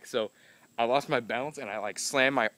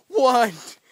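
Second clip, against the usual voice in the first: incredulous what; 12 semitones higher, 9 dB louder than usual. Retold or imitated. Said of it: "And I'm like".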